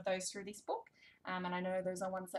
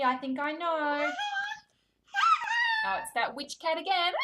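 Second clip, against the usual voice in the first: higher, louder excited build-up; playful anticipation; 8 dB louder than usual. Pretend. Reader finding witch cat with toddler, excited build-up. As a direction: tease the child with a familiar favorite.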